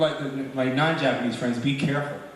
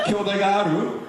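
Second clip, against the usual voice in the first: put-on Brooklyn accent; 5 semitones higher than usual. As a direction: challenge someone in Brooklyn-flavored Japanese. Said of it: "this Brooklyn accent as they're speaking Japanese".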